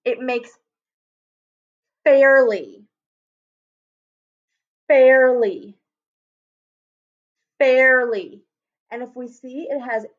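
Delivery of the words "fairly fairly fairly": fairly repeated, air stretched, louder; teacher drilling fairly louder; sounding out fairly. Shown as a demonstration. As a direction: model fairly three times, leaning on air. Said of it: "if I add the L Y".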